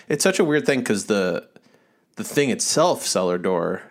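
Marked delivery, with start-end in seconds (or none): none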